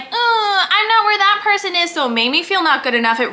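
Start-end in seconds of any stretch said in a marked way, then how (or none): 0.0-1.9 high, whiny, near-crying self-pity; mock whiny despair. Comparing herself to others, a high, crying whine. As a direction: whimper it like you feel left behind. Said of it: "I'm like".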